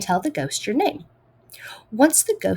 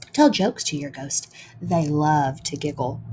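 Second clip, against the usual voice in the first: plain reading voice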